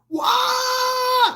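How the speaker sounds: very high loud squeal; mock fanboy scream; fanboy shriek. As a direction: shriek like a crazed fan. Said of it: "fanboy weird stuff".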